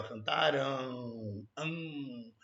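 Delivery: "Cantarão" slow and low, ending stretched, then a soft "An"; drawn-out sound demo. Shown as a demonstration. Teacher letting students hear the nasal sound, drawn-out demo. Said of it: "vê se não tem esse som".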